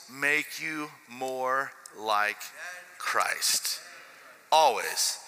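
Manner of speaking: slowing to a hushed close; slow preaching. Acted. Sermon climax, slowing down.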